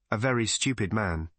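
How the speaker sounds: ordinary delivery, nothing marked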